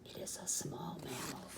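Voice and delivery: voiceless hushed reply; whisper. Acted. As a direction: whisper the answer flatly. Whispered answer.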